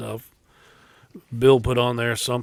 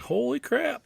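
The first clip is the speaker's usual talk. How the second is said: retold shock; 11 semitones higher, 7 dB quieter than usual. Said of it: "I was like".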